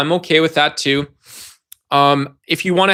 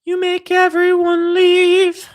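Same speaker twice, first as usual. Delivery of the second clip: squeaky falsetto, slow; slow high falsetto jab; high mock voice; much slower than usual. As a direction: play it as a silly high whine. Opening high put-on voice.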